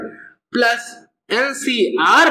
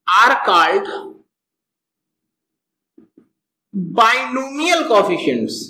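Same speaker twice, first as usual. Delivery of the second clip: slow dictation; 2 semitones lower, much slower than usual. Shown as a demonstration. Teacher dictating notes to class.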